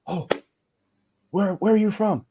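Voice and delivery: high-pitched, curious reaction; imitated stranger's surprised question. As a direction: react with sudden interest, voice jumping up. Imitating strangers hearing his Russian.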